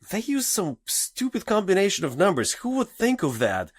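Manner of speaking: high-pitched mock disbelief; incredulous daughter. Daughter telling her sister about the password, imitated scoffing disbelief. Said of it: "she's like".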